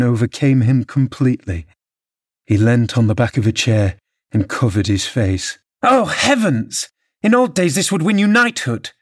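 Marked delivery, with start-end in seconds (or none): 5.8-8.9 mocking exclamation. A narrator's reading. Wife mocking her trembling husband, scornful exclamation. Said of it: "exclaimed Missus Linton".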